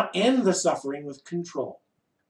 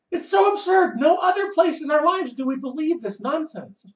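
exasperated; 10 semitones higher than usual. Real, not acted. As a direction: throw up your hands at it. Exasperated outburst at absurdity.

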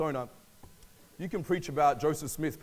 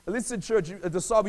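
usual speaking voice

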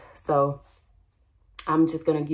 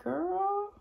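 sassy exclamation; 14 semitones higher, 3 dB quieter than usual. Real, not acted. Describing her messy look.

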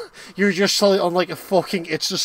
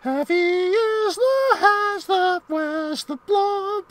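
slow, high mock-regal squeak; goofy high voice; 13 semitones higher, much slower than usual. Player intones in a silly voice friend hates. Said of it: "I don't have to listen to that".

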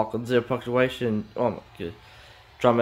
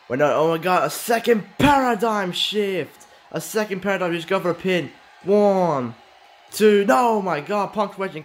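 excited shouting commentary; 7 semitones higher than usual. Real, not acted. Commentator stunned by a second paradigm shift, shouting. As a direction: ride the count, crack at the kickout.

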